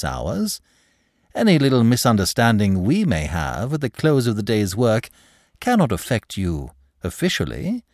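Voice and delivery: suave, glib clerk. Acted. Clerk disputes manager's jurisdiction, glib. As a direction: argue the point with polite assurance.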